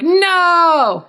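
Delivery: high, far-off villain's cry of defeat; anguished no. Acted. Soren sees his missile explode harmlessly, anguished cry. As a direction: wail at the loss. Soren realizes he's been tricked.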